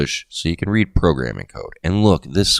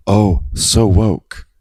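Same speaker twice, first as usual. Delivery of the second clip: stretched out, very slow and louder, a little higher; mock drawled slang; 5 semitones higher, 7 dB louder, much slower than usual. Listing new slang words, in a hammed-up slow drawl. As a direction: ham up a trendy sarcastic drawl.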